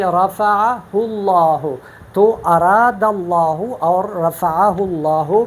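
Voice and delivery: slow recitation of رفعہ اللہ with a full, heavy lam; heavy tafkheem. Shown as a demonstration. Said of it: "پر پڑھتے ہیں".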